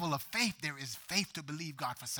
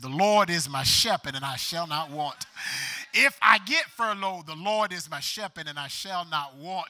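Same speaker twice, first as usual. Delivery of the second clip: louder, defiant declaration; with attitude; 4 semitones higher, 11 dB louder than usual. Shown as a demonstration. Pastor showing the church how to say it with attitude. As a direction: say it like nothing can shake you. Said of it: "say that with some attitude".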